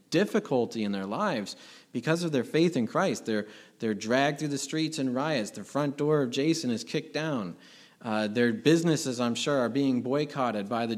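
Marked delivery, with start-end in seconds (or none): none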